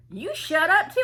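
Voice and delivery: high, loud mock comeback; retold snappish retort. Young man answering the troublemaker, mock angry retort. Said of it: "He was like".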